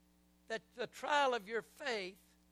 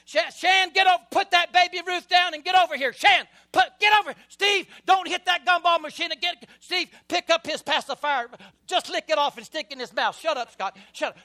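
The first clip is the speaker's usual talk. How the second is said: loud, high frazzled dad shouting; harried father hollering across the checkout; yelling at the kids; 8 semitones higher, 12 dB louder than usual. Retold or imitated. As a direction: bark orders at unruly boys in a store.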